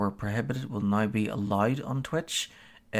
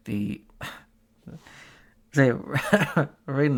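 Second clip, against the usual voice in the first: laughing; 5 semitones higher, 5 dB louder than usual. Real, not acted. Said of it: "not to laugh while reading".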